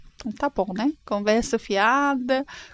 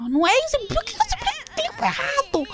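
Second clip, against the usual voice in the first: high, mock-indignant complaint; mock indignation; 13 semitones higher than usual. Player scolding her game husband for explaining wrong, high and indignant. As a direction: scold him in a high exasperated voice.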